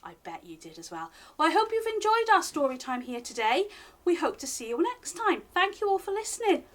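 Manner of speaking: plain reading voice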